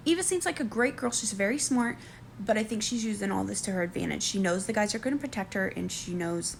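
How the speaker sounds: normal talking voice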